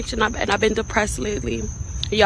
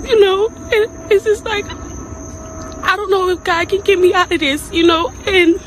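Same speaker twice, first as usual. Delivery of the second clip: high, sobbing, halting despair; real crying; 8 semitones higher, slower than usual. Real, not acted. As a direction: struggle to get words out through sobs. Crying confession to fans.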